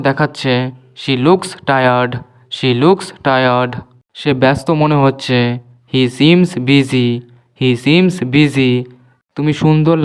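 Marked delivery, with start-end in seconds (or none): 1.0-3.7 slow model reading. Shown as a demonstration. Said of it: "teacher models the sentence slowly". 5.9-8.7 slow model reading. Shown as a demonstration. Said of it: "teacher models the sentence slowly".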